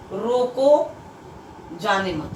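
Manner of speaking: रोको alone, long gap, then जाने मत; pause after रोको. Shown as a demonstration. Teacher explaining the order to stop, pause after रोको. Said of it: "यहाँ पे कहा जा रहा है".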